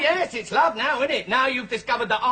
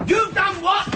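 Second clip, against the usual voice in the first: shocked outrage; 11 semitones higher, 5 dB louder than usual. Acted. Outraged at news of report.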